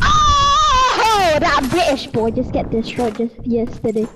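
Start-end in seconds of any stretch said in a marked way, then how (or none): 0.0-1.4 very high, held "oh"; high wailing cry. Real, not acted. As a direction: let out a long high wail. Outcry mocking a beaten player.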